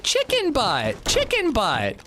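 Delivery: put-on high, slow little-kid voice; toddler voice. Dad imitating daughter reading the mug, high slow toddler voice. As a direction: draw it out like a two-year-old. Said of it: "she's like".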